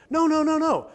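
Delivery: rising, insistent denial; emphatic no. Real, not acted. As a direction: pound out each no. Preacher rejecting uniformity.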